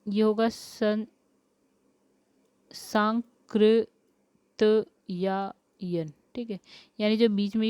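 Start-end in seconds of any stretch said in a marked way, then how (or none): 2.7-6.1 सांकृतयायन stretched out syllable by syllable; dictating सांकृतयायन without सा, slowly; slow spelling-out. Shown as a demonstration. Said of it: "ये सा हटा दीजिए".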